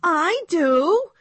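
a contained storybook shout; eager shout. A narrator's reading. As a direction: call it out like the class know-it-all. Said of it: "shouted Little Miss Perfect".

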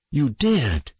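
high, insistent retort; accusing sister. A narrator's reading. Sister accuses brother in quarrel.

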